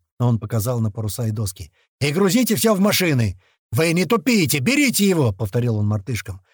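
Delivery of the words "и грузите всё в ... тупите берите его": raised, higher-pitched commands; urgent orders. A narrator's reading. Leader hurrying men and monkeys to load, urgent commands. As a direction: drive them on to load up.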